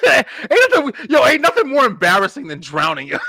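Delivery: loud, high, through laughter; laughing shout. Real, not acted. Friend yelling the punchline of his drowning story, loud and high. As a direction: shout it while still laughing.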